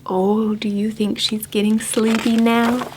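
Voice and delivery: usual speaking voice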